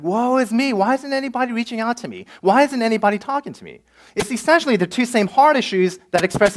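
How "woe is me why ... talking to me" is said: whiny woe-is-me, pitched higher; hammed-up self-pitying lament; mock self-pity. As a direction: moan like a sorry-for-yourself newcomer.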